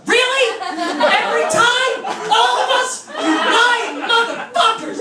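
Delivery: high-pitched indignant disbelief; mock outrage. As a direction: rant at them like they betrayed you. Indignant outburst at restaurant.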